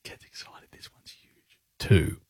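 hushed excited whisper. Acted. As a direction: whisper it behind the countdown. Whispered asides during countdown.

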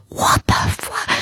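slow, breathy mock-shocked whisper; stunned whisper. Baffled patient thinking to herself in the church, stunned whisper. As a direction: whisper it in retold disbelief, cutting off.

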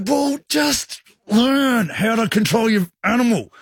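slow, high-pitched retold rebuke; exasperated scolding. Scolding the dog owner who let go, exasperated. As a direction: drag out an exasperated lecture.

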